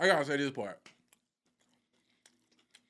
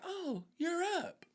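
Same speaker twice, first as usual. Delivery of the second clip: nurse voice; 10 semitones higher, 8 dB quieter, slower than usual. Retold or imitated. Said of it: "She's like".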